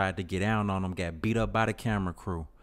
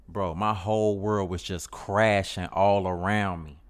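usual speaking voice

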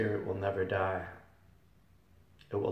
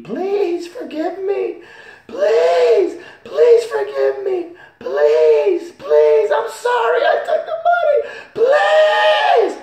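much higher, much louder, slow wailing scream; screaming damned souls; 16 dB louder, slower than usual. Retold or imitated. Damned in hell screaming to be forgiven, shrieking. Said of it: "the people down there were screaming".

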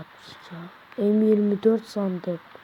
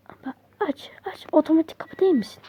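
much higher, fast sarcastic jab at the door; mock outraged; 7 semitones higher, faster than usual. Real, not acted. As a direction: scold the door like a person. Yelling at a stuck door. (kapıya alaycı çıkışma)

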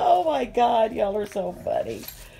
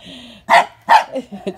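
loud laugh; 3 semitones lower, 9 dB louder than usual. Real, not acted. Laughing at dogs' play.

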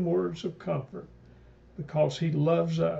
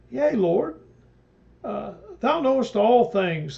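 much higher, louder insistent answer; earnest protest; 5 semitones higher, 5 dB louder than usual. Acted. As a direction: insist desperately on your love. Peter answers Jesus again.

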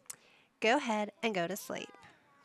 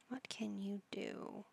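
much softer, slow murmur; 11 dB quieter, slower than usual. Real, not acted. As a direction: mutter the question under your breath, slowly. Repeated question to Sim.

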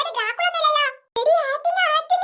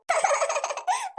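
giggle; 4 semitones higher, 7 dB quieter than usual. Real, not acted. Nervous giggle before acting.

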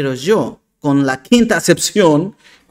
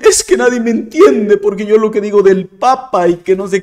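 louder, exaggerated victim whine; mock self-pitying complaint; 6 dB louder, faster than usual. Vlogger mocking critics who complain nobody understands them, louder mock whine. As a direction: lay on the pity, mocking. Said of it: "O, o dicen".

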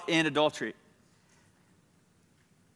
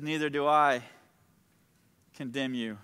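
soft, low, halting absolution; tender reassurance; 4 semitones lower, 5 dB quieter than usual. Acted. Jesus pardoning the accused woman, soft, low reassurance. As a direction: let it drop gently with a long pause.

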